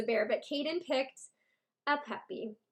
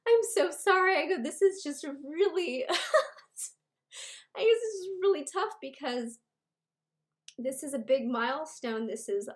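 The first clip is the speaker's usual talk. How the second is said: higher, tearful, laughing through tears; retold tearful apology; 4 semitones higher, 4 dB louder than usual. To the ultrasound tech, apologizing while in tears. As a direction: relive breaking down in front of the tech. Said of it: "I literally started breaking down in tears".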